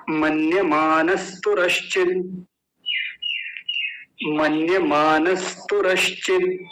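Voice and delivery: slow unbroken chant-like model of the long word; all joined together. Shown as a demonstration. Teacher asking students to say it whole, slow joined delivery. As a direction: say the whole compound slowly in one breath. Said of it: "अब एक साथ बोलेंगे सारे को".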